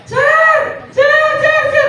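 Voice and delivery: high, loud calling "Sir!". Retold or imitated. Woman outside calling out to him loudly. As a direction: shout to get his attention from afar.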